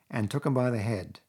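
ordinary delivery, nothing marked

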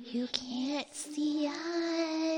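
very slow, hushed ghostly whisper; soft, stretched-out eerie whisper; spooky whisper. Acted. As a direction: draw it out softly like a ghost. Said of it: "Why the fuck are you whispering?".

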